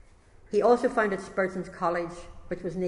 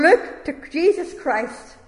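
high, loud, drawn-out shout; preacher's shout; 8 semitones higher, 7 dB louder, slower than usual. Acted. Primitive Methodist preacher shouting at a young stranger, slow loud shout. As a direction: cry it out slowly from the pulpit. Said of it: "Then he shouted, as only a primitive Methodist can".

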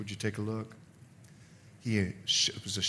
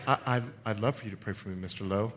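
retold meek acceptance; 7 semitones lower, 5 dB quieter, faster than usual. Retold reply to preacher's offer.

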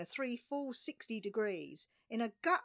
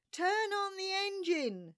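high-pitched, loud yell; screamed order; 12 semitones higher, 5 dB louder than usual. A narrator's reading. Narrator screaming back as the boat is slung over, frantic. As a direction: scream the command across the cockpit. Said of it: "screamed I".

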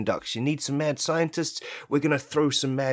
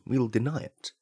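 ordinary talk, nothing marked

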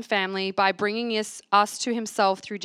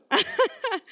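laughing; 13 semitones higher than usual. Real, not acted. Laughs after whoop.

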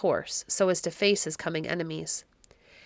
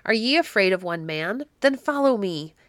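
ordinary delivery, nothing marked

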